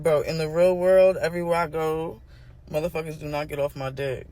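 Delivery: ordinary delivery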